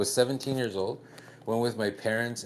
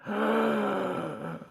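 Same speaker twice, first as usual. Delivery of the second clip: shocked gasp; 12 semitones higher than usual. Retold or imitated. Shocked at crude joke beside family.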